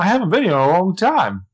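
high, hammed-up British accent on a line of Jordan's; bad British accent. Podcaster redoing Jordan's line in a bad British accent. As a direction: play Jordan in a self-mocking British accent. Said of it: "such a bad accent".

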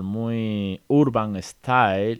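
stretched slowly, streetwise; slow, street-style stretch; drawn-out urban style. Shown as a demonstration. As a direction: draw it out like slang. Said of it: "muy, pero que muy coloquial".

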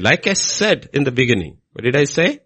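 ordinary delivery

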